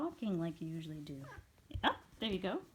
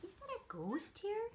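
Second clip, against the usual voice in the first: much higher, squeaky question to the pet; high pet talk. Real, not acted. Asking pet in baby talk.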